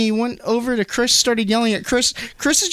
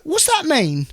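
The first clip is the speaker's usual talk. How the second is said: high-pitched puzzled British impression; mock British questioning; British accent; 9 semitones higher than usual. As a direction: imitate a baffled Brit, pitched up. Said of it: "I just find the British accent funny".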